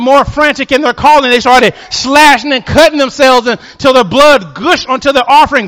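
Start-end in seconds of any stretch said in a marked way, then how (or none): none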